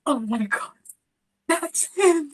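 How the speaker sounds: sharp gasp, then a high, breathless oh my God; starstruck gasp. Retold or imitated. Spotting her musical hero across the stage, gasping in high starstruck shock. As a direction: gasp as if you've just spotted your hero. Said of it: "and I was like".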